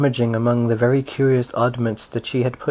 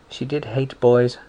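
ordinary delivery

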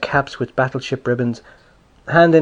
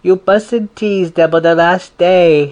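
slow, higher, louder wheezy chiding; wheezy mild scolding; 6 semitones higher, 7 dB louder, slower than usual. A narrator's reading. Big neighbour chiding her children, wheezy and fond. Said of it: "she wheezed".

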